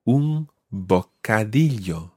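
"bocadillo" drawn out slowly, double L as "ly"; slow model word. Shown as a demonstration. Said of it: "Watch the double L sound".

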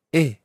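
"e" said alone as a clean vowel; single syllable demo. Shown as a demonstration. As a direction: isolate the "e" syllable. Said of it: "the second syllable is".

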